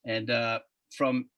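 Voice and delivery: usual speaking voice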